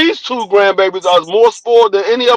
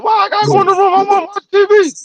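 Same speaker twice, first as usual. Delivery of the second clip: put-on high, complaining kid voice; whiny kids; 11 semitones higher, faster than usual. Grandpa mimicking grandkids protesting, high whine. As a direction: whine like a child sent to their room.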